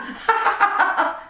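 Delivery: high, breathy cackle; real helpless giggle; laughing fit. Real, not acted. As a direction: dissolve into airy high laughter. Cracks up at lost job.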